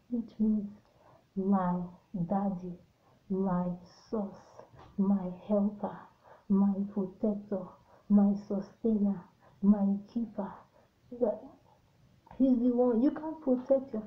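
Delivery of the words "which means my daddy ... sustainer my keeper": ordinary talk, nothing marked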